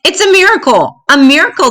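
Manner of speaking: a donkey crying out at his new strength; crying out in wonder. A narrator's reading. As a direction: shout it like you can't believe it. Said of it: "the donkey cried out".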